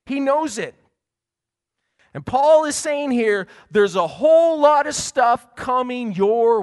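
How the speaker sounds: loud, high preacher's push; preaching at full force. Acted. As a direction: drive the warning home from the pulpit.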